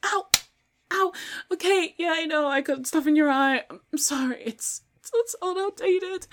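high, loud, pained panic; frightened apology. Acted. Victim whose spray failed, placating attacker, frightened apology. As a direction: cry out and apologize frantically.

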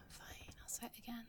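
breathy whispered giving-in. Acted. Giving in to partner's plea, teasing whisper. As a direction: whisper it, mock-reluctant. Teasing refusal before confession.

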